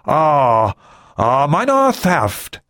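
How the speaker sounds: high, halting, drawn-out reply; stammering. A narrator's reading. Ri nervously explains himself to the President, stammering. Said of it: "he stammered".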